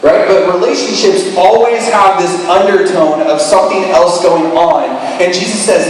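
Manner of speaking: normal talking voice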